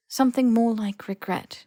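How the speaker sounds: ordinary delivery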